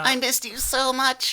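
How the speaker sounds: high, loud gushing reunion. Acted. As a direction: gush over a long-lost friend. Hugging robot friend.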